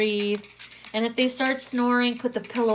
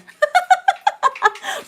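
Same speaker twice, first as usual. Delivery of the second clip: shrieking high laugh, real; high squealing laugh; 6 dB louder than usual. Real, not acted. Laughing at own reading.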